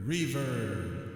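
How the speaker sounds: said in a large echoing room; reverb effect. Real, not acted. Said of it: "a little bit of reverb".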